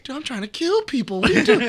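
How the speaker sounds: very high mock-incredulous protest; retold exasperation. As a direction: imitate a comic sidekick freaking out. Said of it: "And he's like".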